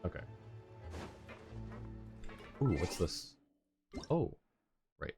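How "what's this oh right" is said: normal talking voice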